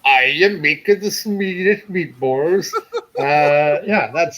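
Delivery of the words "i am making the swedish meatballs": slow, laughing fake Swedish accent; bad Swedish accent. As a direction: play up a cheesy Swedish accent. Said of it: "the worst Swedish accent".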